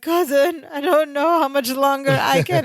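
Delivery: high, straining plea; strained sidekick. Retold or imitated. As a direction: gasp it out while being choked. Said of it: "And Balky's like".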